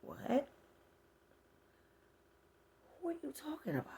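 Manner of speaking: stunned disbelief; shocked. Retold or imitated. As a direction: react like you can't believe the news. Shocked by death rumour.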